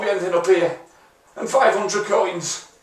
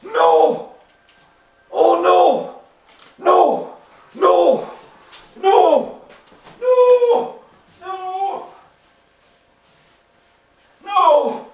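high, loud wails of denial; repeated despairing no; 11 semitones higher, 4 dB louder, much slower than usual. Real, not acted. Gamer wailing over the lost Benzema. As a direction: howl each no in disbelief.

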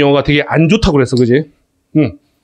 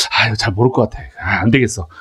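low, softer musing to oneself; writer thinking aloud; 8 semitones lower, 5 dB quieter than usual. Acted. Writer worrying the reader won't understand, low musing. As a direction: voice a private second thought, pitched down. Acts out writer's worried thought.

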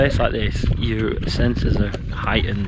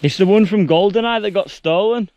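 high, loud and thrilled; excited; 10 semitones higher, 6 dB louder than usual. Real, not acted. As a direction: burst out with delight at the find. Finally reaching the helicopter.

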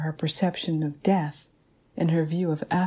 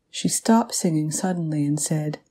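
ordinary delivery

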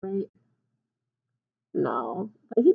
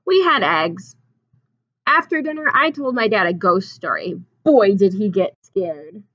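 storybook reading; 7 dB louder than usual. A narrator's reading. Reads picture book aloud.

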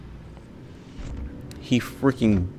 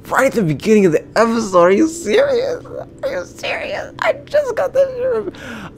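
high-pitched tearful wail of disbelief; mad, whining; 3 dB louder than usual. Real, not acted. Player crashed right at the episode's start, mad wailing. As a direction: squeal in outraged protest, nearly crying. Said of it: "I'm so mad".

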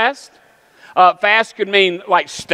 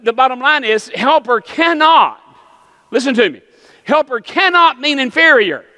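raised, emphatic pulpit delivery; forceful preaching; 4 semitones higher than usual. Real, not acted. Preacher insists helper cannot mean inferior, forceful preaching. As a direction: drive it home, building to a high cry.

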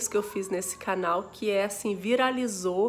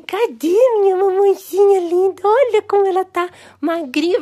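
higher, loud cooing pet voice; 10 semitones higher, 13 dB louder than usual. Real, not acted. Owner cooing to the new mother cat, loud baby talk. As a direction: coo adoringly at the cat.

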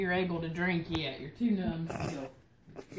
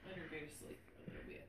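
whispering; 3 semitones lower, 19 dB quieter, much faster than usual. Real, not acted. Letting patient fall asleep.

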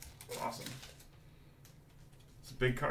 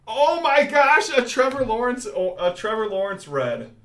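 much higher, much louder thrilled yelling; excited shout; 10 semitones higher, 11 dB louder than usual. Real, not acted. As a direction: shout in real delight at the pull. Pulls a big rare card.

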